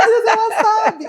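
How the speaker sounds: high, laughing amazement; delighted surprise. Retold or imitated. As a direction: marvel at the daughter, laughing. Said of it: "eu falei".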